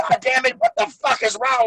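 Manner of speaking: high, exasperated retold yelling; imitating a fuming wrestler in the ring; mock angry yell. Rick Steiner yelling, retold.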